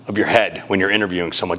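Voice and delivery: ordinary talk, nothing marked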